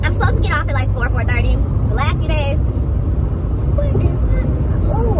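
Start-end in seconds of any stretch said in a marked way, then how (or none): 2.6-5.2 whispered. Real, not acted. Complaining about traffic.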